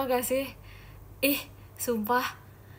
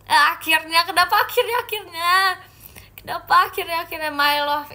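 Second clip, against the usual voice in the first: high, loud incredulous repeats; flustered surprise; 6 semitones higher, 7 dB louder than usual. Real, not acted. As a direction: squeal the question in disbelief. Startled by being called my love. (kaget heboh bersuara tinggi)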